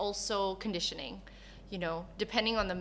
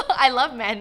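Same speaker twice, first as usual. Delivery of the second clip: louder, words carried on a laugh; 3 semitones higher, 11 dB louder than usual. Real, not acted. Laughing while repeating the answer.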